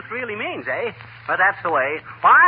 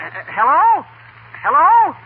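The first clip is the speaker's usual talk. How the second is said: louder, higher hello into a dead line; calling into the phone; 7 semitones higher, 6 dB louder, slower than usual. Real, not acted. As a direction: call out to check if she's still there. Caller hung up.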